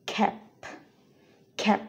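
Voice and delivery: "খ্যাপ" with a small puff on the final p; careful "খ্যাপ", final p audibly released; lightly aspirated ending. Shown as a demonstration. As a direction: release the last p of "খ্যাপ" softly with breath. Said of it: "অনেকটা aspirated ওয়েতে উচ্চারিত হবে".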